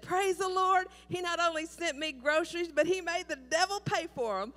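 high, loud, jubilant shouting; ecstatic praise. Retold or imitated. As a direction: burst out in triumphant joy. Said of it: "jumping up and down and clapping her hands and said".

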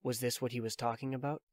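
ordinary delivery

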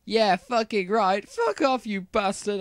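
high, loud mock-furious scream. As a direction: tell him off with a hammed-up sneer. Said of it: "I screamed".

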